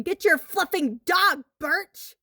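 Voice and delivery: loud, high cursing yell; angry shout. A narrator's reading. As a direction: shout it at him, furious.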